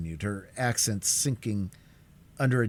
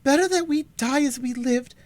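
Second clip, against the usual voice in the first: high, louder, desperate refusal; young sister refusing; 7 dB louder than usual. A narrator's reading. Alice refusing the bargain, high and desperate.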